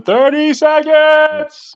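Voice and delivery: high, slow drawn-out call of the time; timekeeper call. Shown as a demonstration. Host shows off the timekeeper's call to the guest. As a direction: belt it like a ring timekeeper.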